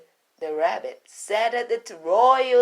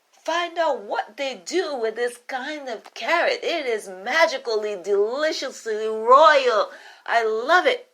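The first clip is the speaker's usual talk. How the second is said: pleased royal; 6 semitones higher, 5 dB louder than usual. Acted. Royal orders the cook.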